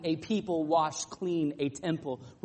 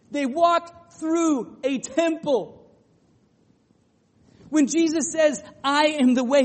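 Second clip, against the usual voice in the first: loud, higher, soaring sermon delivery; preaching at full force; 7 semitones higher, 9 dB louder than usual. Acted. Pastor to congregation, preaching the I-am sayings at full force. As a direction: proclaim each claim to the back row. Preacher builds through the I-am claims.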